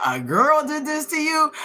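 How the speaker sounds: high, amused mock disbelief; incredulous teasing, voice jumps up; mocking cops. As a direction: imitate cops ridiculing him. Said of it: "looking at him and laughing at him".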